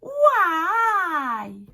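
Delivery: «Why» loud, high, long swoop up and down; rising then falling. Shown as a demonstration. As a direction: stretch «Why» over a big pitch arc. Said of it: "on monte et on descend".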